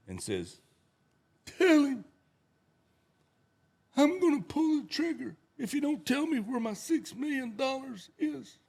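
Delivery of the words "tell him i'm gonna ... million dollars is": high mock gangster threat; mob boss. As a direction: push the threat up high and hard. Mob boss voiced in joke.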